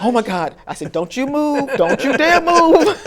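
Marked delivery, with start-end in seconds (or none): 0.0-0.5 loud, high burst of joy; excited scream. Retold or imitated. Finding James Clark in the directory, joyful outcry. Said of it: "And I started screaming". 0.9-3.0 much higher and louder, laughing, slowing on dare; excited shouting. Retold or imitated. Spotting the name on Early Street, laughing shout. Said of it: "And I started screaming".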